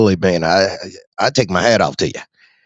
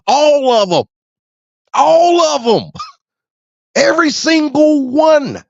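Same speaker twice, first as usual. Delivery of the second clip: worked-up shout. Real, not acted. Ranting to listeners about all politicians, high laughing shout. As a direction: blast every last one of them.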